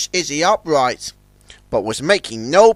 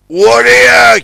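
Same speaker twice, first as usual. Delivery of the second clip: loud, high alarmed yell; a bargeman yelling at a reckless tug; 7 semitones higher, 10 dB louder than usual. A narrator's reading. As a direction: yell his name in alarm. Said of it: "yelled Big Mac".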